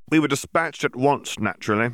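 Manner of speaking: a touch of smug officialdom; lightly voiced smug Auror. A narrator's reading. Yaxley voiced in reading.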